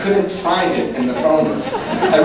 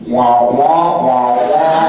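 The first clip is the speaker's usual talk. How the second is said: imitated muffled cartoon-teacher "wah wah"; mock droning speech; 3 semitones lower, 4 dB louder, much slower than usual. Meeting speaker heard by a newcomer, mock droning. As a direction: drone out wordless wah-wahs, louder, singsong.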